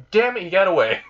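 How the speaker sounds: high, exasperated outburst; mock frustration, pitch jumping up; frustrated exclamation. Rias at the gate after Issei leaves, frustrated.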